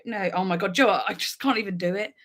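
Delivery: ordinary talk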